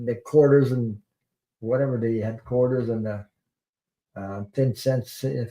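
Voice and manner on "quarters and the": ordinary talk, nothing marked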